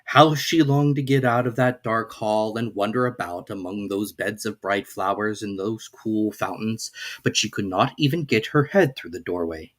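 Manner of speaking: plain reading voice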